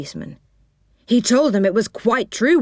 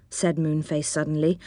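ordinary delivery

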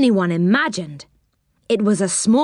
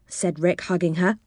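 ordinary delivery

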